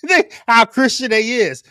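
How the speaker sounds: laughing mock echo of the clip; amused repeat. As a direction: echo the quote, cracking up. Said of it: "laughs, repeating the clip".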